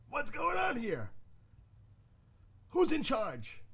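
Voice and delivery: high, slow mock movie outcry; hamming up the film character's demand; movie quote. Retelling a movie scene, a high mock cry. Quoting Dreyfuss in Close Encounters.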